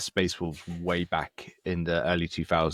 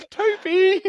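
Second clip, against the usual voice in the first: laughing shout; 9 dB louder than usual. Pretend. Laughing call to the narrator.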